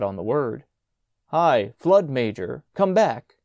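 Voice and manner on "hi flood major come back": loud call across sand. A narrator's reading. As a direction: call him back loudly. Prefect calling boy back.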